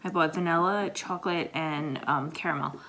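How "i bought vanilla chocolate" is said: normal talking voice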